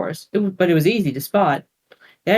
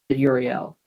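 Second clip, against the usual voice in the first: ordinary delivery, nothing marked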